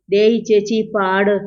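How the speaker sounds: usual speaking voice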